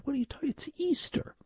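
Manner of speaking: high, soft incredulous wife; high, hushed imitation of a baffled wife. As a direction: squeak it as the puzzled wife. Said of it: "She goes".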